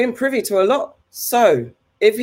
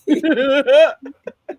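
higher-pitched burst of laughter; real laugh; 8 semitones higher, 3 dB louder than usual. Real, not acted. Co-host reacting to a friend's disbelief about Epstein, real laughing. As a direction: laugh out loud at the absurdity.

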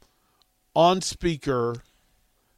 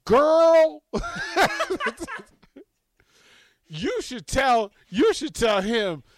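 put-on sassy girl talk; 7 semitones higher, slower than usual. Cheering the stranger at the airport table, high and sassy. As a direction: egg her on like a girlfriend. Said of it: "I'm like".

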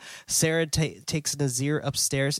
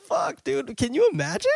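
much higher-pitched, stunned reaction; aghast exclamation; 14 semitones higher than usual. Real, not acted. Co-host reacting to lights failing in the haunted house, aghast. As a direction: blurt it out high, as if picturing the horror.

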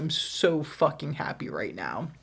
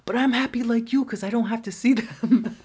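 higher and louder cheerful imitation; mock careless guy; 7 semitones higher, 6 dB louder than usual. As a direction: sound pleased with himself. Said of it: "And he's like".

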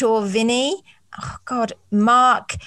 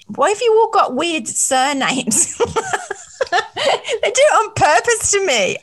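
helpless giggles, pitch climbing high; tickled and laughing in mock complaint; giggling; 10 semitones higher than usual. Real, not acted. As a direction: laugh through the words, voice shooting up. Giggling helplessly over hard surnames.

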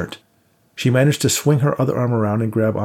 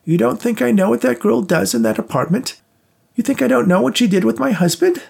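put-on high, louder old woman rant; raving old woman; 14 semitones higher, 4 dB louder than usual. A narrator's reading. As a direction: rave it at her, half-mad. Rose raving, continued.

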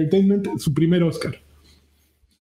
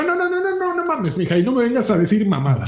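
exasperated protest; 4 semitones higher than usual. Retold or imitated. Podcaster retelling his reply to a friend's correction, exasperated. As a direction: protest high, then rattle off the rebuke.

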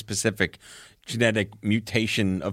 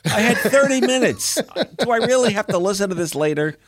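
whiny mock listener; 9 semitones higher, 3 dB louder than usual. Imagined listener complaining.

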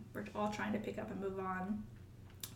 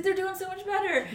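higher, quick, cheery colleague voice; imitating Clayton; 10 semitones higher, 7 dB louder than usual. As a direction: bring good news to a tired nurse, bright and high. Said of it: "He's like".

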